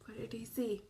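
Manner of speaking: hushed whispered question. Shown as a demonstration. Reader teasing the children, whispered. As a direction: lean in and whisper it. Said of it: "reader whispers question to children".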